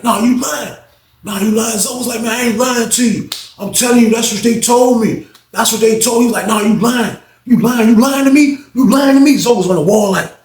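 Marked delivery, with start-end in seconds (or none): 0.0-1.8 high, insistent accusation; voicing an inmate calling out a lie, pitched high; accusing. Reggie accusing Zoe. 2.2-6.2 defensive plea; voicing a nervous inmate, pitched up and pleading. Zoe pleading innocence. 6.5-9.4 rising, louder accusation; voicing an inmate getting angrier and louder; building accusation. Reggie escalating accusation.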